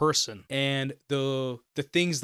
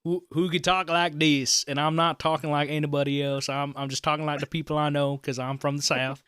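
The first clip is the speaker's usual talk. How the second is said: Southern accent; 2 semitones higher, 5 dB louder than usual. Shown as a demonstration. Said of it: "I don't know how to get out of this voice now".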